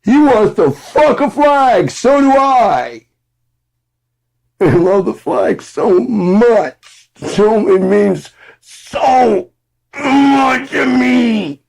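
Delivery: shrieking high fan voice drawn out; mock gushing fan. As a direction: ham up a dim, adoring superfan. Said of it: "your fans are like".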